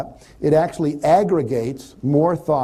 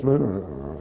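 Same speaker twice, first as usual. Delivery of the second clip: low, soft, deflated sigh of a word; hammed-up gloomy mumble; mock dejected slump; 8 semitones lower, 4 dB quieter than usual. Shown as a demonstration. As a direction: droop like someone who feels bad. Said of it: "a woman who's walking around like this".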